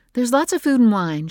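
high and loud, brushing it off; dismissive mother. A narrator's reading. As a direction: wave the guests away.

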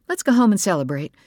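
cheerful mother. Acted.

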